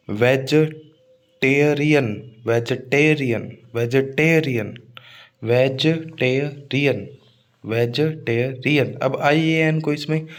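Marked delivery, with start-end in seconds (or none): 0.1-8.9 slow vegetarian in syllables, veg-uh-TAIR-ian; stress on tier. Shown as a demonstration. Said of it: "पहले वाला syllable है टेयर". (Vegetarian टुकड़ों में, टेयर पर ज़ोर)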